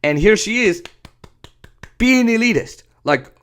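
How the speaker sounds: ordinary delivery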